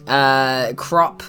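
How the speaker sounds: ordinary talk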